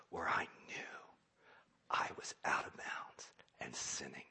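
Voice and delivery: low, breathy whisper; ashamed whisper; whispered confession. Real, not acted. Confessing hardest part, hushed.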